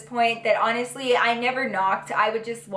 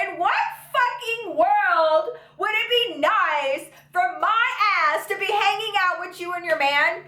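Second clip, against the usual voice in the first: indignant outrage; 6 semitones higher than usual. Retold or imitated. Scoffing at hanging out with friend's man, outraged. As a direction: stretch out the disbelief.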